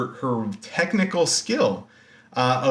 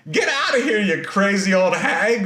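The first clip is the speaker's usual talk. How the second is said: mock rude Arachne; 6 semitones higher than usual. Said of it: "and is like".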